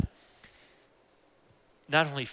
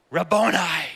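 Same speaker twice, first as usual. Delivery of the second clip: joyful cry of recognition; 4 semitones higher, 9 dB louder than usual. Acted. Mary recognizes Jesus, cries out.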